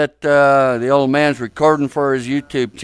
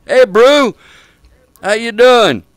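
louder, higher-pitched hail; calling out; 6 semitones higher, 4 dB louder, slower than usual. Real, not acted. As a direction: call over to someone across the room. Calls out to another player.

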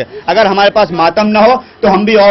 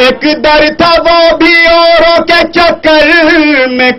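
stately nazm recitation; 3 semitones higher than usual. Shown as a demonstration. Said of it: "پیش کرتا ہوں چار مصرے".

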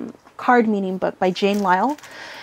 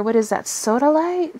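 ordinary delivery

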